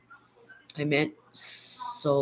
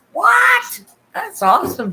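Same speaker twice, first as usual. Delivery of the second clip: surprised; 10 dB louder, slower than usual. Real, not acted. Hearing about drone deliveries.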